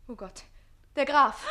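loud startled cry; panic, he's coming; alarmed. Acted. Page hearing the count, alarmed cry.

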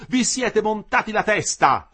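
slow, loud, high scolding; angry rebuke. A narrator's reading. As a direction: hammer it at them slowly. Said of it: "ripeté".

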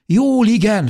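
high, loud quick answer; urgent reply. A narrator's reading. Injured mother answers child, urgent cry. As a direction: call back over the chaos.

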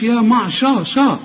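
"شا" with a thick, dark a; mistaken heavy sh. Shown as a demonstration. Teacher mimicking the wrong reading, heavy sh. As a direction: back the vowel in "شا". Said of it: "ما تقولش".

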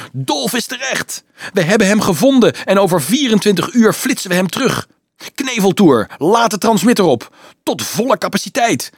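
high, loud, jubilant voice; overjoyed doctor calling out; excited imagined call. Acted. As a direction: shout the good news down the phone.

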